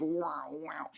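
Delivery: stomach noise. Retold or imitated. Stomach answers back with a soft sad whine. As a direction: whimper it like a hungry stomach. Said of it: "And it goes like".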